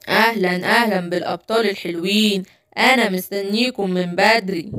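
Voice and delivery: low, soft sneering villain greeting; low sly villain. Acted. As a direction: welcome them into the trap, gloating. Gorilla villain greets trapped heroes.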